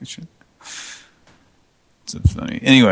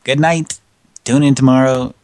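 higher, louder host farewell; show sign-off; 4 semitones higher, 7 dB louder than usual. Acted. Host signing off the show to listeners. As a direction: boom the goodnight like an emcee.

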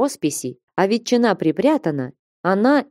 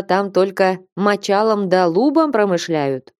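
ordinary delivery

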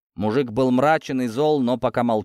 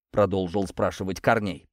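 usual speaking voice

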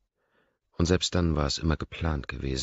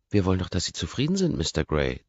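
teasing; 4 semitones higher, 5 dB louder than usual. A narrator's reading. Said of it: "zieht sie mich auf".

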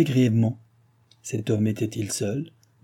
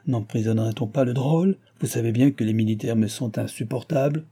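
usual speaking voice

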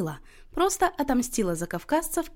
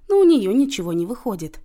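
ordinary delivery, nothing marked

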